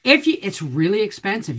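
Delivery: ordinary delivery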